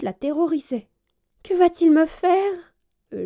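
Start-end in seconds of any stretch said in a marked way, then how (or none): 1.4-2.7 fearful question. A narrator's reading. Princess dreading the dentist.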